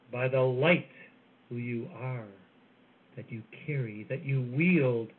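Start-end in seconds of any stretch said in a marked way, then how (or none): none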